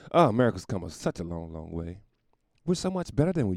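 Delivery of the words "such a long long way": ordinary delivery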